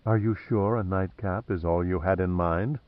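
low, soft and sultry; a hint of sultry teasing. A narrator's reading. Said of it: "Julia replied in a sultry voice".

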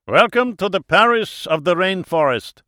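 high, loud host with a German lilt; slight German accent. A narrator's reading. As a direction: welcome them grandly in a German accent. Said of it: "he said with a slight accent".

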